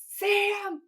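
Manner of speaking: very high, drawn-out imitated wail; Dean crying out. Brother cries over dying Sam, crying out. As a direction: wail the name, acting out grief. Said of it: "and then he goes".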